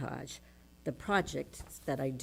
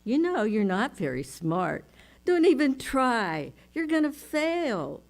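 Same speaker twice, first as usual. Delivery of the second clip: rising, louder taunting voice; inner critic; 5 semitones higher, 7 dB louder than usual. Retold or imitated. The inner critic taunting her, rising and sneering. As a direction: sneer the put-down. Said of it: "But she says".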